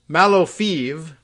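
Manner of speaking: "Malofeev" drawn out slowly, ending in "feev"; Westernised pronunciation. Shown as a demonstration. Host showing the Western spelling's sound, "Malofeev" ending in "feev". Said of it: "also spelled in the West as".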